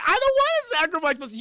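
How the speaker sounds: loud, high-pitched objection; hammed-up reluctant sidekick; mock protest. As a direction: wail it in comic protest. Eddie protesting his sacrifice.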